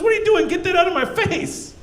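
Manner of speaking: high, indignant girlfriend, lightly voiced; girlfriend's voice. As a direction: push it away in a mock-annoyed high voice.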